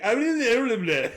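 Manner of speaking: put-on cheerful gibberish; happy babbling. Little brother at the episode's end, happily babbling in mimicry. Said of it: "he's all happy".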